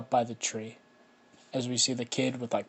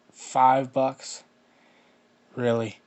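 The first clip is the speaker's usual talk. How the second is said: scornful bully; 7 dB louder, much slower than usual. Acted. Bully scoffs at the small payment.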